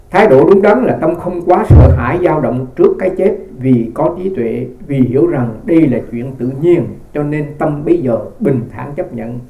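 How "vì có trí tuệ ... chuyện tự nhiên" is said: normal talking voice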